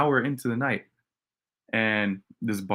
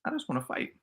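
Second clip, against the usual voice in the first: softer aside to oneself; inner thought; 2 semitones higher, 7 dB quieter, faster than usual. Retold or imitated. Itching for a fight in his head, quiet thought. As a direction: say it softly, as a private thought. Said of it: "In my head, I'm like".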